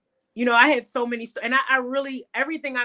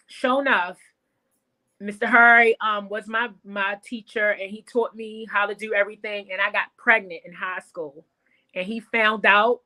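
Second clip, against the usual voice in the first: ordinary talk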